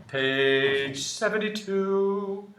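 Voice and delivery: slow sing-song page number; drawn-out announcement. Shown as a demonstration. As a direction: stretch it out playfully.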